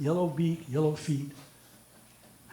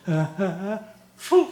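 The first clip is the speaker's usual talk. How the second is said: mock satisfied grunts. Man answering judge, chicken joke.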